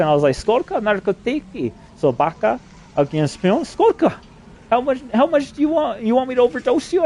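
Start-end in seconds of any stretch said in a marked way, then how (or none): 0.3-4.1 much higher, slower broken Russian words; mock Russian. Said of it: "I learned a little Russian". 4.7-7.0 mocking, much higher-pitched taunt. Taunting Russians in retold quote.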